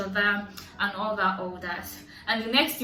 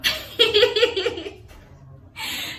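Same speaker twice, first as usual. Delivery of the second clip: high, loud burst of laughter; 12 semitones higher, 6 dB louder than usual. Real, not acted. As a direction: laugh at how absurd it was. Laughs at retold scene.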